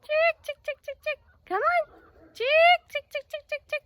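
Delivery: high, loud "chick, chick"; loud chicken call. Real, not acted. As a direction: call the hen across the yard. Calling the chicken in.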